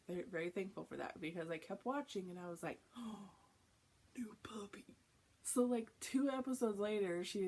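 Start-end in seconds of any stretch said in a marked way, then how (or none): 3.0-4.8 soft gasp of wonder; hushed delight. Retold or imitated. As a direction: breathe it quietly, as a sweet discovery. Reacts to news of a puppy.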